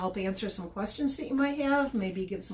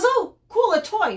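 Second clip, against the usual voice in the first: much higher and louder, a delighted dog spotting the toy; excited dog voice; 12 semitones higher, 8 dB louder than usual. Retold or imitated. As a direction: squeal it like a dog seeing a toy. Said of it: "and he goes".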